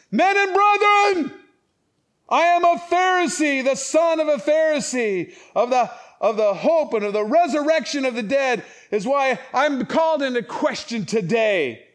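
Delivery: loud, high proclamation to a council; crying out. Acted. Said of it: "He cried out in the council".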